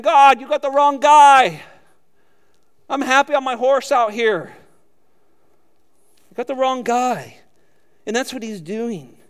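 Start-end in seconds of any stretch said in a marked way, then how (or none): 0.0-7.4 retold loud protest. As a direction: plead with God that he picked wrong. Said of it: "I remember saying".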